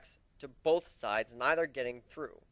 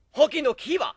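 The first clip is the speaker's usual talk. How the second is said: shouted attack name; 12 semitones higher, 9 dB louder than usual. A narrator's reading. Opponent calls out sword technique.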